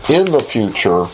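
ordinary talk

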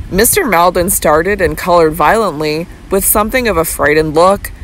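usual speaking voice

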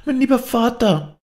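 put-on little girl wailing after her father; pitiful child crying out. A narrator's reading. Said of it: "klägliche Kinderstimme".

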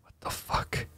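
breathless whispered disbelief; hushed, spooked whisper; stunned whisper. Real, not acted. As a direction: whisper it in shock. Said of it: "a little bit creepy".

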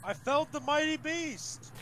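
grandiose boast. Acted.

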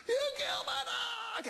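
very high mock shout; freaking out. Sergeant accusing man who kicked his dog, freaking out. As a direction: imitate a hysterical, high-pitched accusing scream. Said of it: "this guy's freaking out now".

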